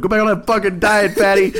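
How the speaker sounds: gleeful high heckle at the comic; mock heckle. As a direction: shout the heckle across the room. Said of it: "I was like".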